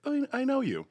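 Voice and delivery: high, surprised Oh I know you; high surprised recognition. Retold or imitated. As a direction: squeal it like spotting someone. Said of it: "where people are like".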